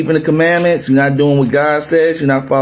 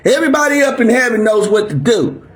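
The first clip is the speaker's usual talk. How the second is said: usual speaking voice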